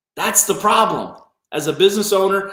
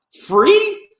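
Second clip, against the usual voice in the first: incredulous; 13 semitones higher than usual. Real, not acted. A coach scoffing at free leads, incredulous rise. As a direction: pitch shoots way up on free.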